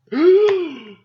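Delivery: loud, high, hammed-up gasp; mock horrified gasp. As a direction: imitate a scandalized, affronted gasp. Acts out the offended wife.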